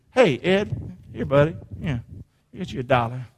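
ordinary talk, nothing marked

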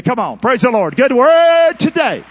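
loud, high closing shout; preacher calling out praise to end the service; preacher's shout. Real, not acted. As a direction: rouse the church at the end.

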